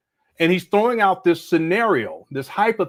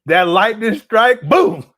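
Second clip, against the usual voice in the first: booming, hammed-up lover's line, much louder; loud mock Umar; 8 dB louder, slower than usual. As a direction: bellow it mockingly like a cocky seducer. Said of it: "Umar said".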